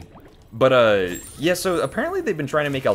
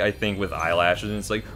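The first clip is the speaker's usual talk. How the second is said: normal talking voice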